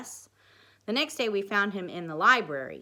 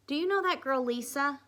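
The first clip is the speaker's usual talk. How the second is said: put-on girl voice; 8 semitones higher, 3 dB louder than usual. A narrator's reading. Amy voiced high.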